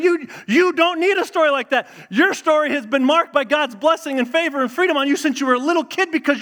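high, forceful acted scolding; emphatic reproof. Older student setting a complaining freshman straight, high and emphatic. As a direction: drive it home like an insistent older friend.

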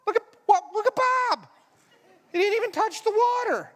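very high-pitched amazed cries; astonished onlookers. Acted. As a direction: squeal it in sheer disbelief. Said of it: "he got up and went".

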